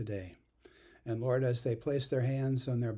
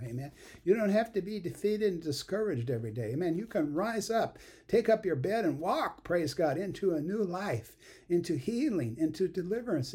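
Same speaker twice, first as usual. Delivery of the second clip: high, rising revival preaching; fervent preaching; 5 semitones higher than usual. Real, not acted. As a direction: preach it out, building fire. Preaching at full force.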